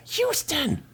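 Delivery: high-pitched hammed-up call. Yardrat hailing a comrade, high mock call. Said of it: "Yardrat goes".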